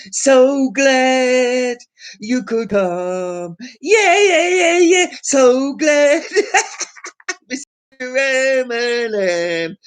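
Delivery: high, drawn-out gushing welcome; camp gushing host. Pretend. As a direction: fawn over a celebrity guest. Hammed-up greeting to [name].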